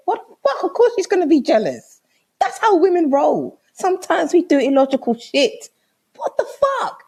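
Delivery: high, incredulous rant; exasperated outburst. Retold or imitated. Woman explaining jealousy to clueless boyfriend, exasperated. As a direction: pitch it up in disbelief at his excuse.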